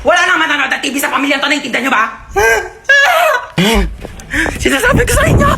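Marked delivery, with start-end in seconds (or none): 2.1-4.6 hammed-up high villain laugh; high evil cackle. Maligno cackling high over the family. 4.6-5.5 high, far-off villain voice; mock villain warning. Maligno warning the family in a high villain voice.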